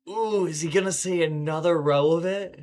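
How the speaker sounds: high, loud mock suspense; mock excitement. Player wondering if the line repeats again, hammed-up excitement.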